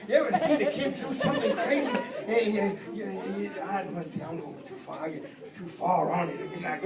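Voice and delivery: ordinary talk, nothing marked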